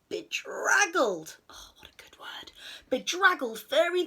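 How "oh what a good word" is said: soft, delighted whisper aside. A narrator's reading. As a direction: whisper it to yourself. Narrator's aside.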